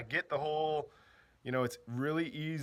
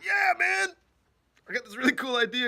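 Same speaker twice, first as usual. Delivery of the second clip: hyped Russell; 10 semitones higher, 6 dB louder than usual. Retold or imitated. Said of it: "He's like".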